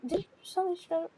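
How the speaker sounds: ordinary talk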